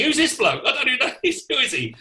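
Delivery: a hint of a frazzled stage manager; flustered stage manager. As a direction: rush on stage confused. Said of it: "He said".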